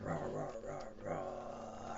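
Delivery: soft, low rumbling roars; low dinosaur growl. Acted. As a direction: growl low to build suspense. Low roars before the challenge.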